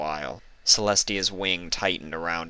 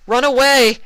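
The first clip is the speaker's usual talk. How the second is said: high, loud terrified cry; panicked shout; 11 dB louder than usual. Acted. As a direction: yell it as you flee in panic. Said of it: "individual shouts and screams".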